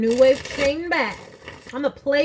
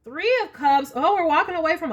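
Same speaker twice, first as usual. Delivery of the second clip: excited; 6 semitones higher, 5 dB louder than usual. Real, not acted. Surprised at many cup cards.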